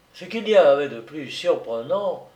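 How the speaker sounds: usual speaking voice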